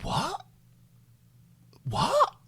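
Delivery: high squeaky disbelieving "what?". Retold or imitated. Caller hearing the wait is longer, incredulous. Told another three to four hours.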